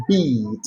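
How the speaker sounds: "Bead" on a steady long E; long vowel in bead. Shown as a demonstration. Teacher drilling "Bead" against "beard", steady vowel. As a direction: hold the E in "Bead". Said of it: "This is".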